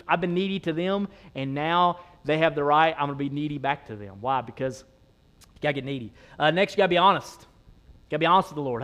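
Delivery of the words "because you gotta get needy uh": usual speaking voice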